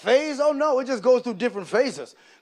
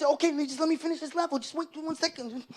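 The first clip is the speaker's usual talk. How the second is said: soft, higher absent-minded stalling; distracted gamer son; 5 semitones higher, 7 dB quieter than usual. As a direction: put dad off without leaving the game. Son stalling dad while gaming.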